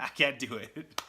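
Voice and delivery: real giggle breaking into the words; laughing. Real, not acted. Reader giving up on the tongue-twister, laughing. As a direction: give up on it with a laugh.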